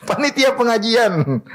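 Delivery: slow, high-pitched amused echo of a claim; amused disbelief. Real, not acted. Preacher repeats the policeman's claim to listeners, amused and incredulous. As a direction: laugh into a high, drawn-out repeat.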